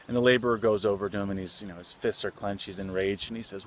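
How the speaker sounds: usual speaking voice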